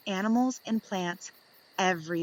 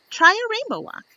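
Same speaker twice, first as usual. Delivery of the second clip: bright excited; 13 semitones higher, 9 dB louder than usual. A narrator's reading. Read-aloud tips, cheerful emphasis.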